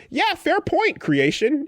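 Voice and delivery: squeaky high mocking voice; high mock character voice. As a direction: pitch way up, mock him. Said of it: "And he's like".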